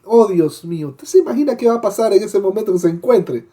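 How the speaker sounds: ordinary talk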